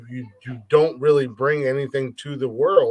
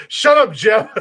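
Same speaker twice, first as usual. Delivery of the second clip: heated family shouting; 8 semitones higher, 8 dB louder, slower than usual. Real, not acted. Playful shouting at Jeff.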